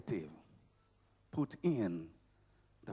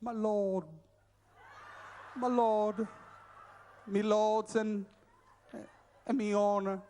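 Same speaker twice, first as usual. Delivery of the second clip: mock plummy British accent, a bit higher; a touch of mocking posh British accent; 4 semitones higher than usual. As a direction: lightly send up a posh British speaker. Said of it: "somebody who talks, you know, like".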